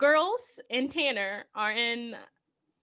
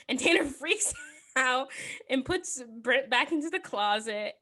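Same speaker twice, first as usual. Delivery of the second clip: ordinary delivery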